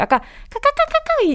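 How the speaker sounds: high squeaky dolphin chatter; dolphin click imitation. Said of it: "이 소리가 너무 귀여웠어요".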